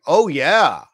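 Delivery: mock eager trader. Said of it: "And you're like".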